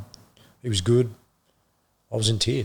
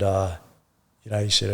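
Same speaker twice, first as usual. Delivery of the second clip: usual speaking voice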